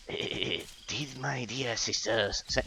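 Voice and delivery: slow, soft creepy spider voice; creepy whispery spider. Acted. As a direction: hiss it like a cursed spider. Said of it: "Spider voice".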